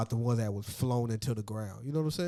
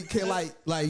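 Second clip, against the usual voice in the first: ordinary talk, nothing marked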